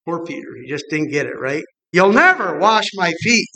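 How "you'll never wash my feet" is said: "never" stressed with a slow rising push; emphatic refusal. Retold or imitated. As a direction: Peter flatly refusing, slowed down. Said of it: "An emphatic, a contradiction".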